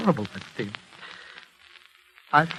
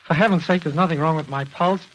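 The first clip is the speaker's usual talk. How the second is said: higher and louder, bristling with irritation; agitated outburst; 6 semitones higher, 4 dB louder than usual. Acted. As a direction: snap back, offended at being examined. Patient bristles at the pulse check.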